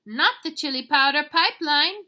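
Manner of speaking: high, loud mock dismay; alarmed declaration. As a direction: exclaim it in disbelief. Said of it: "declared Scapito".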